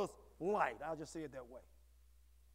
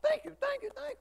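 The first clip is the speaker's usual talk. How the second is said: high, loud, gloating devil voice taking credit; smug devil; 6 dB louder than usual. Retold or imitated. Preacher voicing the devil taking credit, high smug gloating. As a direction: gloat like the devil accepting applause. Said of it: "He said".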